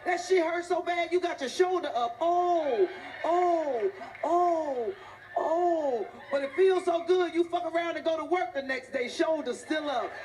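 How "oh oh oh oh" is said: shrieking jackpot winner. Retold or imitated. Acted pain and pleasure cries.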